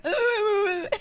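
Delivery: high howling imitation cry; dog-like mock sobbing. Friend imitates groom's sister crying, dog-like sobbing. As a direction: sob like a howling dog, mocking. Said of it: "she sounded like a dog".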